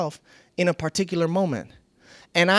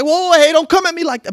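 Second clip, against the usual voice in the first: loud, high "whoa, hey" pushback; defensive shout; 9 semitones higher, 8 dB louder than usual. Pretend. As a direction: bark it back defensively. Acting defensive retort.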